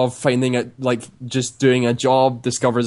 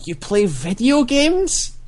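high, drawn-out incredulous question; disbelief; 10 semitones higher, much slower than usual. Retold or imitated. Imitating the lab supervisor hearing about the games society, incredulous. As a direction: mimic someone astonished, slowly rising to the end. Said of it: "the sound of disbelief in his voice".

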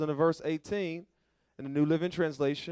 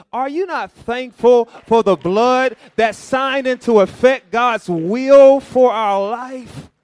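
loud, slow, high preaching cry; preaching at full force; 8 semitones higher, 14 dB louder, slower than usual. Real, not acted. Preacher urging the congregation, loud and slow. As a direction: lift it to the rafters, drawn out.

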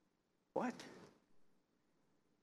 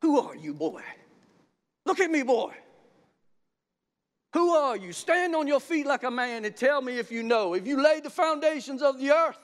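loud, commanding voice of God; God challenging; 10 semitones higher, 7 dB louder than usual. Acted. God confronting a doubting man, loud and commanding. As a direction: thunder down the questions.

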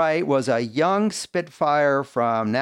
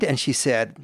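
ordinary talk, nothing marked

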